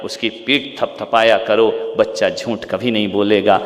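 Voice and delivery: normal talking voice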